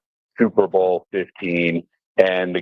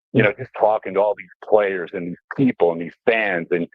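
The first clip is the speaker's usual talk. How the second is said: usual speaking voice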